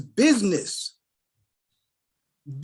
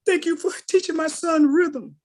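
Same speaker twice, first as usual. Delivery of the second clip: higher, sweet thanks from a parent; grateful mother; 8 semitones higher than usual. Retold or imitated.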